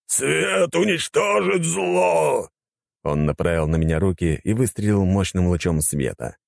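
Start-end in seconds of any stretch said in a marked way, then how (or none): 0.1-2.5 high, drawn-out battle cry; holy zealot on the attack; fanatical cry. A narrator's reading. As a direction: cry it high, stretching every word. Fanatic cries out before attacking.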